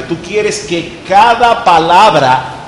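very slow, every word separate; slow and clear. Shown as a demonstration. Teacher modelling unhurried speech, very slow. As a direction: spell out each word for the room. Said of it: "Eso es sumamente importante".

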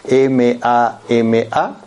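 slowly spelling out M A M A letter by letter; spelled out. Shown as a demonstration. Teacher spelling a word for the class, slow and letter by letter. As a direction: say M A M A one letter at a time, slow. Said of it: "son cuatro lebra-, letras de la palabra mamá".

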